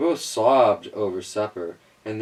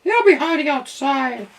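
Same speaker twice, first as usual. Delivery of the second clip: very high, loud meowing cat voice; scoffing cat; 14 semitones higher, 5 dB louder than usual. A narrator's reading. Cat boasting to the squirrel, high and loud. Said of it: "scoffed the cat".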